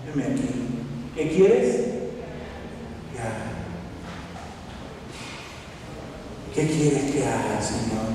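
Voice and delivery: low, hushed and halting prayer; humble surrender. Acted. One who receives Christ to the Lord, quiet low submission. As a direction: offer yourself softly, with long pauses.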